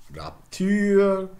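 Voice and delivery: "Rapture" as rap-TÜR; high, loud "Rapture", stressed at the end; French-style pronunciation. Shown as a demonstration. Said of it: "more examples".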